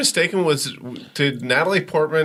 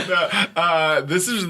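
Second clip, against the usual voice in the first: ordinary talk, nothing marked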